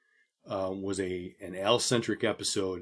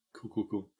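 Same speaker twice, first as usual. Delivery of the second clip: lightly voiced deadpan cool cool cool, softer; a hint of the sitcom character's flat repeat; Abed impression; 7 dB quieter than usual. Host doing his friend's favourite sitcom catchphrase, lightly imitated and quieter. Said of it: "Do my, do my Abed impression".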